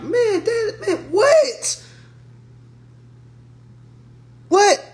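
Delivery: high, laughing shout of disbelief; incredulous shout. Pretend. Fly on the wall reacting to bad therapy advice, shouting in disbelief. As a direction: cry out in disbelief, half laughing. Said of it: "just be like".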